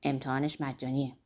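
soft, low aside; said quietly. A narrator's reading. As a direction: murmur it back on the sly. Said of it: "رستم هم آهسته گفت".

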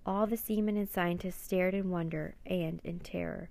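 usual speaking voice

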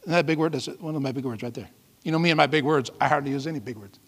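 low, soft joking aside; offhand aside. Real, not acted. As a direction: drop out of preaching into a quiet self-teasing remark. Joking aside about own vocabulary.

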